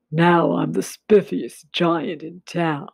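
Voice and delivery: lightly voiced proud giant. A narrator's reading. Giant proudly showing off his new clothes. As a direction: puff up a little with pride. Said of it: "he said proudly".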